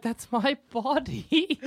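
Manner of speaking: slow, high, soft whine; mock lament. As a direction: slowly moan over the lost body. Dog-self lamenting.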